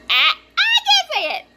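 high squeal through laughter; giggly, very high-pitched protest; laughing. Real, not acted. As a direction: crack up at your own fumble. Laughing at own tongue-tie.

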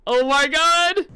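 very high, loud, drawn-out scream; terrified shriek. Real, not acted. Streamer spooked by the game, shrieking. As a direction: shriek at the scare. Jump scare reaction.

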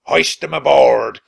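loud pirate shout. Acted. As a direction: shout to the crew. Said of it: "Captain Bones shouted to his men".

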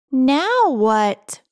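ordinary delivery

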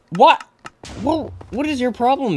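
high-pitched indignant disbelief; startled protest. Real, not acted. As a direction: squeal a shocked complaint at your attacker.

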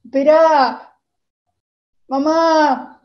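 loud high call. Retold or imitated. Girl calling to her frantic mother, loud high call.

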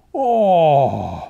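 loud, drawn-out falling groan; disappointed sulking. A narrator's reading. Rooster refused by the pigs, sulking in disappointment. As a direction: let the oh slide down in a sulk. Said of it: "maulte Theodor enttäuscht".

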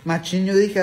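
ordinary talk, nothing marked

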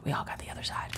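hushed, secretive whisper; soft conspiratorial whisper about the dark side. Acted. Confiding about the shadow self.

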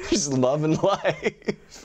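high, drawn-out laughing words; cracking up at himself; laughing while talking. Real, not acted. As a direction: stretch it out through laughter. Laughs at his own story.

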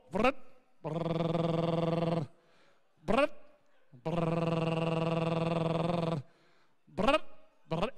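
imitated dial whirring, low buzzing; rotary phone noise. Shown as a demonstration. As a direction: mimic an old rotary phone dialling. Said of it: "I'm making a phone call".

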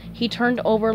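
ordinary delivery, nothing marked

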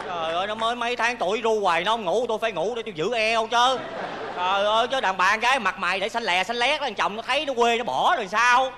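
loud, comic self-justification; indignant protest. Acted. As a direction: defend yourself loudly, mock-aggrieved. Comic mother defends falling asleep. (lớn tiếng phân bua)

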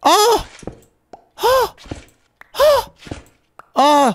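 short high-pitched "oh" cries; suggestive cries. Pretend. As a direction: series of sudden high, suggestive "oh"s. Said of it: "c'était un peu, euh, tendancieux".

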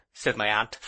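ordinary delivery

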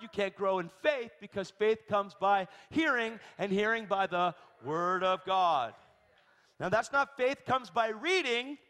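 loud, high sermon delivery, stretching key words; forceful preaching. Real, not acted.

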